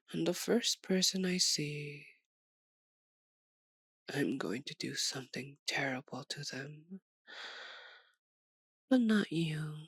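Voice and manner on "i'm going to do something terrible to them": low, soft, half-whispered threat; hushed menace. Acted. Vampire threatening whoever she sees first, hushed menace. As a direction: sink into a dark near-whisper.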